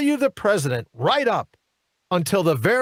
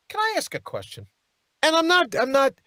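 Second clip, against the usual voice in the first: incredulous; 9 semitones higher than usual. Real, not acted. High incredulous aside.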